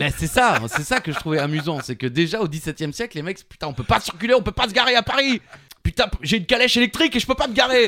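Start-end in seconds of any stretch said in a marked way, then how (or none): 3.7-7.9 laughing, higher, louder grumbling coachman; exasperated coachman. Pretend. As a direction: rant like a fed-up carriage driver. Mocking exasperated 17th-century drivers.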